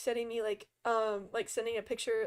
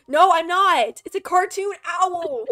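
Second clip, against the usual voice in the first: loud, higher-pitched indignant denial; indignant protest; 6 semitones higher, 9 dB louder than usual. Real, not acted. Streamer denying fear to teasing friends, indignant. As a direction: protest loudly that they have it wrong.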